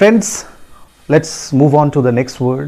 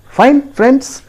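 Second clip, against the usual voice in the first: ordinary talk, nothing marked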